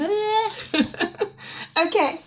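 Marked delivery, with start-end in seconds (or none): 0.0-0.5 sandwich cry. Acted. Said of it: "Look, he's going".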